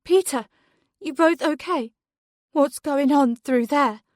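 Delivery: mother calling to her son through the walls. A narrator's reading. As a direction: call out to find them.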